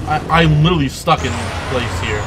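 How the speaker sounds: loud exasperated; loudly fed up; frustrated loud. Real, not acted. Frustrated stuck in place.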